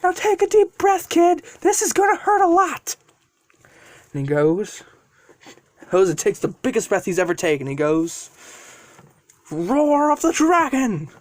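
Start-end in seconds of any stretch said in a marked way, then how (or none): 0.0-3.0 high-pitched, urgent yelled warning; yelling dragon. Acted. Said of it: "And then Draco yells". 9.5-11.1 slow, high-pitched attack call; battle cry. Acted. Calling out attack after deep breath.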